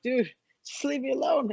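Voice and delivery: high, fed-up plea to be left alone; annoyed brush-off. Retold or imitated. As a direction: groan it like you're sick of him. Moody fighter snapping at coach.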